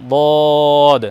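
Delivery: বদ held long with tongue side; Arabic letter demo. Shown as a demonstration. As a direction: draw out বদ slowly. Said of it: "side লেগে উচ্চারণ হবে". (বদ জিহ্বার side লাগিয়ে টেনে)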